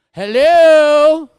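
high, loud call; loud hello. Real, not acted. Pastor shouting at the congregation, loud and high. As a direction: wake the room up.

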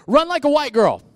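loud, high shout to be echoed; called-out response. Acted. Pastor shouting the slogan for students to repeat, loud. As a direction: punch RUN and belt the phrase. Leader calls congregation response.

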